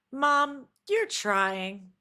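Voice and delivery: imitating a daughter cheering her mom on; daughter's pep talk. Daughter telling her mom she is trying, encouraging and slow. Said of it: "looked at her and said".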